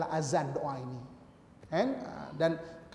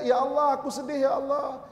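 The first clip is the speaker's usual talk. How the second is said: 1 mock lament; 7 semitones higher, 3 dB louder than usual. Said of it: "Jangan kata".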